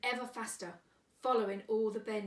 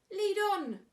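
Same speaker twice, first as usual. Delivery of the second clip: loud high eager call; eager cry; 12 semitones higher, 8 dB louder than usual. A narrator's reading. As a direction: cry it out greedily. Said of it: "cried the rat".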